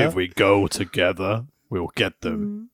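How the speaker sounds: low, quiet, slow movie line; mock dramatic dub. Dubbing wrestlers' dialogue, low slow mock drama. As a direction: intone it like a solemn hero.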